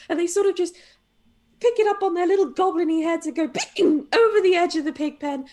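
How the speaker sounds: ordinary delivery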